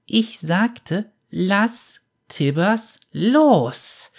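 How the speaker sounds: put-on high, slow, menacing child's voice; wrathful warning. A narrator's reading. Girl warns the bounty hunter in cold fury, slow and menacing. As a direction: spell out each word as a threat. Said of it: "Die Worte grollten voller Zorn".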